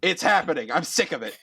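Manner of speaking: exasperated judge, pitched high; worked-up, high-pitched warning; fed-up threat. Acted. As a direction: threaten them like you've had enough.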